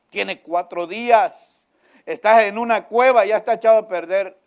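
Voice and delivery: loud, high insistent objection; urgent protest. Pretend. Lazarus's sister protests loudly to Jesus. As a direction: press the point urgently.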